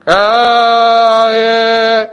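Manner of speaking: held high trumpet tone; sung trumpet call. Shown as a demonstration. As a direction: sing it like a trumpet sounding. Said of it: "you hear that?".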